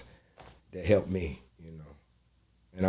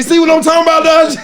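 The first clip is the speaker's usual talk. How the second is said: much higher, louder vindicated yell; comic shout; 14 dB louder than usual. Retold or imitated. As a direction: shout "told you so" at her. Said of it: "I said".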